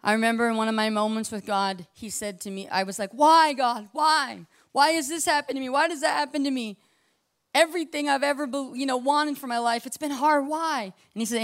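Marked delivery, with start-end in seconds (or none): 3.1-10.9 high-pitched, acted cry to God; pleading complaint. Acts out questioning God in distress.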